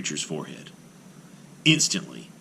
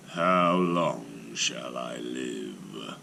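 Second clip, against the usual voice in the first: very slow, low giant's voice; a simple giant asking innocently; 5 semitones lower, much slower than usual. Acted. Golem asks rabbi.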